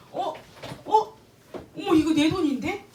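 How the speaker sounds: sudden high startled "어? 어?"; startled realization. Retold or imitated. At the ATM, realizing the money is hers, startled. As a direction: jump up loud with surprise, then a laugh.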